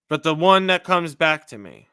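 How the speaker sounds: ordinary talk, nothing marked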